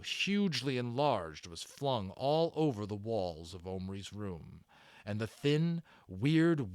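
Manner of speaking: usual speaking voice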